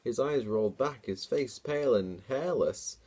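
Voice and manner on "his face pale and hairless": plain reading voice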